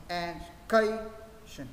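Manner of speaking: "education" slowly split, pausing after E and after CA; two separated beats. Shown as a demonstration. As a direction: break "education" into beats, leaning on E and CA. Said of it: "Thế mình sẽ được hai nhịp".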